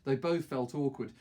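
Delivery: usual speaking voice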